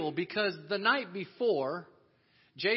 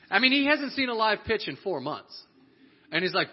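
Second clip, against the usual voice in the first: normal talking voice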